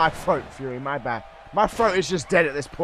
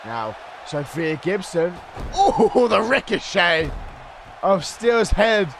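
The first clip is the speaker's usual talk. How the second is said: loud, higher, hyped commentary; play-by-play; 6 semitones higher, 5 dB louder, slower than usual. Acted. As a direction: call the match like a ringside announcer. Live wrestling play-by-play.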